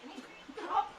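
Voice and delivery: high, delighted mm; savoring delight. Real, not acted. Tasting food, savoring.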